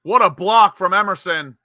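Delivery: loud, high burst of praise; excited match call. Real, not acted. Commentator hailing Emerson's block, excited shout. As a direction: exclaim it at the top of the play.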